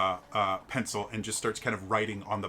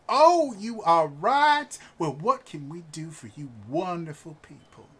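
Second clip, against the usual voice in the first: gushing noblewoman; 6 semitones higher, 7 dB louder than usual. Acted. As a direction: chirp back sweetly. GM voices a gushing wife.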